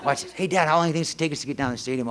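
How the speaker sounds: rattled off fast, innocently; fast sly question. Retold or imitated. Son baiting his dad about the stadium, fast and sly. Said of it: "He'll tell".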